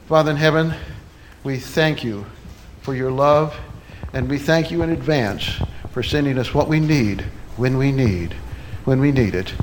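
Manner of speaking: low-pitched, measured prayer in short phrases. Real, not acted. Leads closing prayer.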